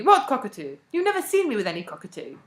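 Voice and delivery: loud, bewildered denial; exasperated protest, starting loud; desperate. A narrator's reading. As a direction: deny it desperately. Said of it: "asked Barker desperately".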